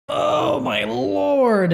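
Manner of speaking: breathy oh, then my Lord cracking high; overcome with emotion. Real, not acted. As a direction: voice breaking as the tears win. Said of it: "I apologize, guys. Trying to not be like".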